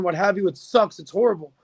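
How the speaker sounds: ordinary talk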